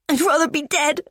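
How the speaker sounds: high and broken, said through sobs; sobbing. Acted. Daughter telling her mum she wants to die, sobbing. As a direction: choke it out between sobs. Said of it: "Between the sobs".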